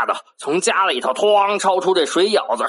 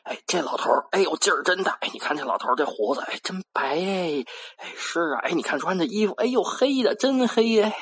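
hushed, softer gossiping voices; villagers whispering; 6 dB quieter, faster than usual. Acted. Villagers secretly gossip about the strong old man, hushed. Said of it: "有的人就小声说".

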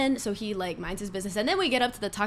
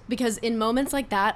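ordinary delivery

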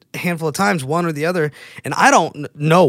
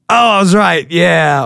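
excited; 4 semitones higher, 7 dB louder than usual. Real, not acted. Pleased to get the answer.

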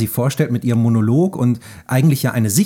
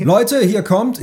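mock royal announcement, pitched high; hammed-up herald calling out the queen; 11 semitones higher, 4 dB louder, much slower than usual. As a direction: announce her like a medieval herald.

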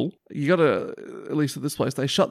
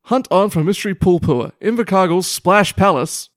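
news reading; 5 semitones higher, 7 dB louder than usual. Acted. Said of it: "read the first line of the article out verbatim".

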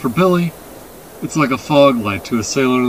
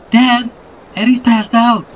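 put-on high, raised child's voice; alarmed boy; 10 semitones higher, 4 dB louder than usual. A narrator's reading. Boy tells dad his sister fainted, alarmed. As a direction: call out urgently to your father.

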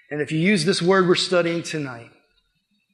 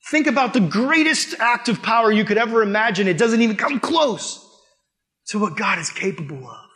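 high, loud, driving preacher's climax; preaching at full force; 7 semitones higher, 4 dB louder than usual. Acted. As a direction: build it like the peak of a sermon, ringing and insistent.

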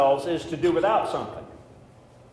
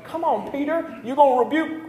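teasing Jesus; 11 semitones higher, 4 dB louder than usual. Acted. Jesus voiced teasing Peter.

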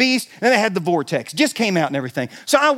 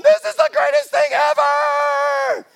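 thrilled shout; slower than usual. Retold or imitated. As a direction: whoop it like a thrilled rider. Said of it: "everybody else is, like, going".

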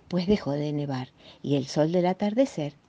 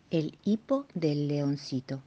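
ordinary delivery, nothing marked

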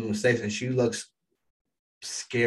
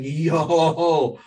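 louder, higher drawn-out "yo"; hyped astonishment; 5 semitones higher, 7 dB louder than usual. Retold or imitated. Fan reacts to Tengen's put-down, loud hyped astonishment. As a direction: exclaim it like a stunned fan. Retells own shocked reaction.

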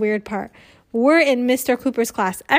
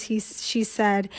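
ordinary delivery, nothing marked

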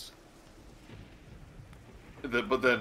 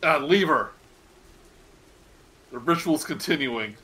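a commanding cultist, louder; leader's command; 4 semitones higher, 6 dB louder than usual. Acted. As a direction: order them off firmly. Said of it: "Your voice goes".

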